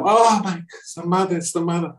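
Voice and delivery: high, gushing fan squeal; starstruck excitement. Retold or imitated. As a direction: gasp it out like meeting a pop idol. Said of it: "leaping up".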